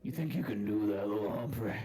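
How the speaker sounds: deep voice. Acted. Low fast taunt.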